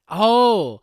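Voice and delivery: high, loud sudden realization; surprised oh. Real, not acted. Realizing what happened in the video, surprised. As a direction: burst out as it clicks.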